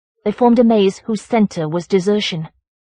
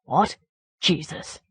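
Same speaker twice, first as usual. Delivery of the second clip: soft muttered words, trailing lower; muttering; 3 semitones lower, 7 dB quieter, slower than usual. A narrator's reading. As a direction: mutter to himself.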